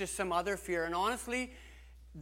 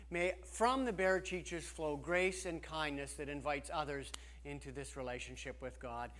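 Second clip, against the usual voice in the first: ordinary talk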